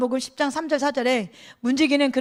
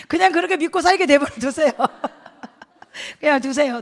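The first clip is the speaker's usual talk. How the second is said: words breaking into real laughter; amused, laughing appeal; 3 semitones higher, 5 dB louder than usual. Real, not acted. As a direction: say it through a laugh. Laughing at children's literal belief.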